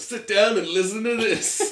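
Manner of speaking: high, loud, drawn-out comic yell; hammed-up shouted order. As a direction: bellow it as a bossy storyteller, for laughs. Old lady starts her story, joking.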